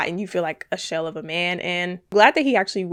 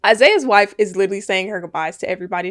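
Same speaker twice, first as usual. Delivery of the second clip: ordinary talk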